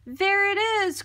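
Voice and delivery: scared frog spotting the monster; frightened cry. A narrator's reading. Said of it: "cried Frog".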